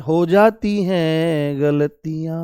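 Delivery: very slow, stretched-out syllables; drawn-out answer. Real, not acted. Teacher giving the class the plural, slow and drawn out. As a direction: draw each word out long, almost chanting.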